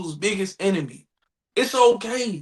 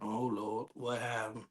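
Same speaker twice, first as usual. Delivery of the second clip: low dread; 12 semitones lower, 18 dB quieter, slower than usual. Real, not acted. Listener bracing, low voice.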